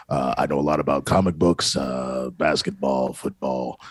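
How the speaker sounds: low creepy announcer voice. Pretend. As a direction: keep the deep creepy voice going. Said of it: "Commit to it".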